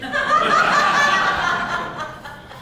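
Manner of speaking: sudden shrieking laugh; high burst of laughter. Real, not acted. Date laughing at the Shirley MacLaine joke, high laugh. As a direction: let out a high squeal of a laugh.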